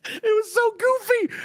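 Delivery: high, choked-up laughing cry; half sobbing through a laugh; teary and high. Real, not acted. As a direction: voice cracking with tears. Crying-laughing about the moment.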